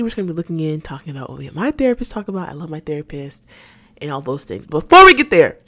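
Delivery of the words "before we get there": loud high segment call; announcer call. Real, not acted. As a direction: announce it like a jingle.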